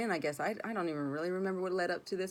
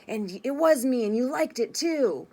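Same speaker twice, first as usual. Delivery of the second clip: higher, much louder sneering taunt; menacing taunt; 7 semitones higher, 9 dB louder than usual. Retold or imitated. Man turning in his chair to taunt her with a chilling confession. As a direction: throw it at her like a gloating threat.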